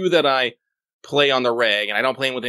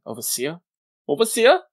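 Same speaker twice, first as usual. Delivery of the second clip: questioning, badly faked German accent; unsure German accent, pitched up by the second try; attempted German accent; 7 semitones higher than usual. Shown as a demonstration. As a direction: try out a German Overseer, rising at the end. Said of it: "how to do a German accent".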